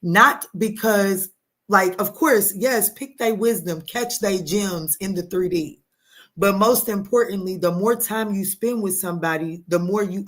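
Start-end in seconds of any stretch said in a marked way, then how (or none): none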